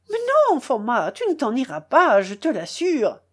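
high opening burst, then insisting; frightened cry. Acted. Frightened uncle forcing Foma back down, crying out. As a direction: cry out to stop someone leaving. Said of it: "criait-il".

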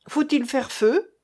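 plain reading voice

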